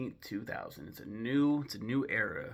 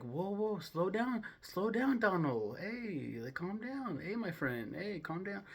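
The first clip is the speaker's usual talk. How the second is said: hammed-up calming of a wild friend; playfully imitating pals settling Donald down; mock soothing friends; 7 semitones higher than usual.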